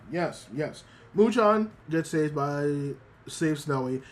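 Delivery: ordinary talk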